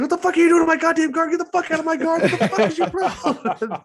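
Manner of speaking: fast, high-pitched angry rant; imitated yelling. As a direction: imitate a manic game character screaming obscenities rapidly. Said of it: "He's fucking yelling at you".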